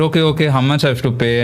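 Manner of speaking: fast, playing along; quick faux-naive question; rushed mock compliance. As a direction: gabble it like a fake eager victim. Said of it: "I said".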